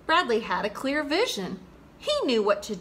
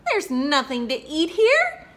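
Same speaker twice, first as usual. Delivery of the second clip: louder, higher dismay with a laugh in it; hungry frog finding his log empty, laughing dismay; exclaimed; 5 semitones higher, 6 dB louder than usual. Acted. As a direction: cry out in comic shock. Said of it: "exclaimed the poor frog".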